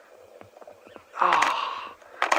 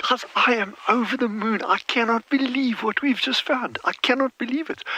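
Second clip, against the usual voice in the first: over the moon; 8 semitones higher than usual. Real, not acted. Thrilled at finding ducklings.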